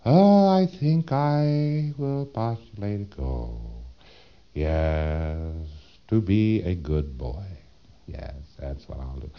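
a lightly voiced dreamy Thetan, slow; a hint of a drowsy spirit pondering its goal; musing Thetan. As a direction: slowly muse aloud to yourself in space.

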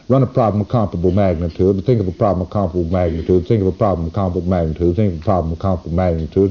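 quick, low-key rote chant; rapid repeated command. Shown as a demonstration. Lecturer demonstrating the experiment's command, rapid rote repetition. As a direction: rattle it off identically each time.

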